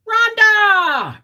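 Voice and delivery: high, loud joyful shout of "Rhonda!"; excited call. Real, not acted. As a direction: sing out "Rhonda!" happily. Calling out to a viewer.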